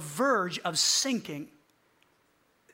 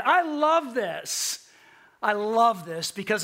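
ordinary talk, nothing marked

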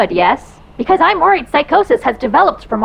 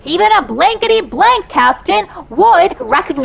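louder repeat; 7 semitones higher than usual. Acted.

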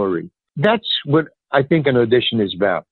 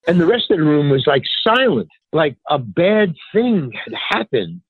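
ordinary delivery

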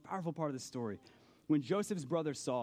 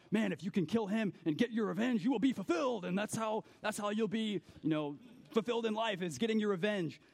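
higher, hammed-up action-hero hype; action movie revenge; 6 semitones higher than usual. Action films preaching revenge, mock hyped. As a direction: sell revenge like a movie trailer. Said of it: "It's all about".

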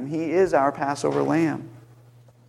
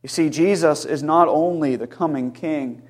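ordinary talk, nothing marked

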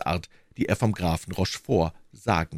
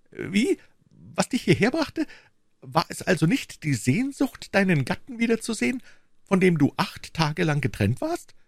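high, offended husband's voice; more deeply hurt; 12 semitones higher than usual. A narrator's reading. Wounded and reproachful. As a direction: shopkeeper asks his wife why she really came. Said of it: "fragte der Krämer, noch empfindlicher verletzt".

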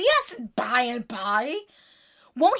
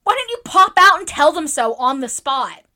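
loud, higher-pitched indignant demand; 5 semitones higher, 10 dB louder than usual. A narrator's reading. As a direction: fire the question out in indignation. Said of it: "cried Joe indignantly".

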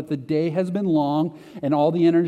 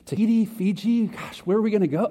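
mock excitement; 5 semitones higher than usual. Imagining the inheritance trip.

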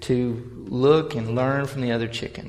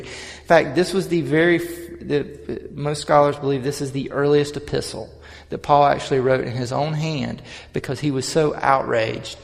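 preaching; 2 semitones higher, 4 dB louder than usual. Real, not acted. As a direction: preach it with rising fire. Preaches on Galatians with force.

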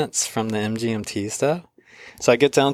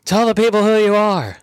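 higher, louder stage call; onstage announcement; 9 semitones higher, 5 dB louder than usual. Retold or imitated. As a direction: announce it to the crowd. Donovan hands him the mic.

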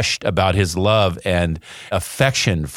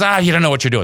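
higher, louder mock scoff; a mild retold brush-off, pitched up; mom dismissing scout; 9 semitones higher, 3 dB louder, much faster than usual. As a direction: toss it off like a fed-up mother. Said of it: "And she goes".

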